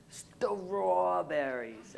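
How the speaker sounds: strawberries very drawn out, high, with a laboured st-raw; over-careful pronunciation. Retold or imitated. Student proving it to the class, strawberries laboriously over-pronounced. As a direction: stretch strawberries out slowly, sound by sound. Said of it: "I practiced and practiced".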